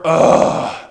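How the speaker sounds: a voiceless, put-upon ugh; breathless grumbling ugh. Acted. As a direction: sigh it out like a sulky complainer. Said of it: "We murmur".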